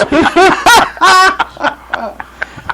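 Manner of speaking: high laugh. Real, not acted. Co-host laughs at own joke.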